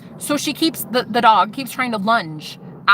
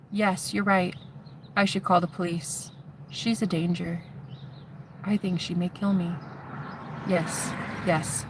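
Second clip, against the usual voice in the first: soft, slightly lowered reluctant voice; hushed, resigned retelling; subdued agreement; 3 semitones lower, 9 dB quieter than usual. Retold or imitated. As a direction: give in quietly, pausing between phrases. Retelling his giving in to her.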